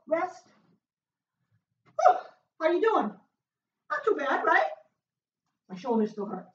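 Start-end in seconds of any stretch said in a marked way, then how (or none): 1.9-2.2 short, high, loud woo; sharp high whoop of effort; exertion whoop. Real, not acted. Trainer starting a rest after push-ups, high whoop.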